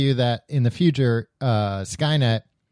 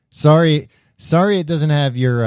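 loud, higher mock apology; indignant, sarcastic defence of a favourite; 6 semitones higher, 6 dB louder than usual. Real, not acted. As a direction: throw a sarcastic sorry at your friend. Mock apology to a critical friend.

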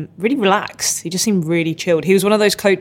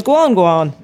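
louder mock crowd call of GUAN, GUAN; cheering on; 5 dB louder than usual. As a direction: call GUAN, GUAN out like everyone egging him on. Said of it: "So everyone goes".

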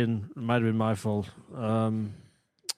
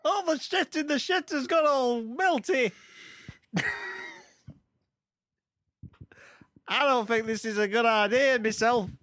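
high comic voice. Acted. Improv astronaut overheating.

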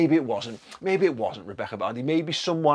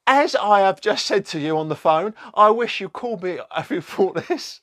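higher-pitched mock reply voice; put-on Rebecca voice; 8 semitones higher, 5 dB louder than usual. Reading out her indignant reply, put-on voice. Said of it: "don't know if that's a voice".

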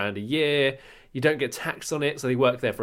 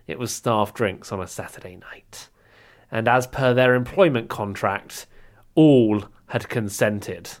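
dramatic storyteller voice; story narration; 4 dB louder than usual. Acted. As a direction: read it like a saucy tale. Reads his comic story.

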